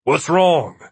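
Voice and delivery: urgent, concerned question; worried. A narrator's reading. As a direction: worry edging the voice. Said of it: "edged with great worry".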